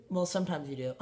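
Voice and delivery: ordinary talk, nothing marked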